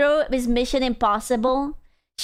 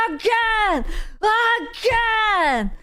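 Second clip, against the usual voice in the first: high, slow mock hero shout; retelling teammate's hero lines - high, stretched mock shout; Bucky's voice lines; 8 semitones higher, much slower than usual. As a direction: ham up the character's battle cry. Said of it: "He was like".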